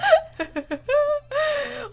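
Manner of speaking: high-pitched long giggle; laughing. Real, not acted. Player cracking up mid-game, laughing.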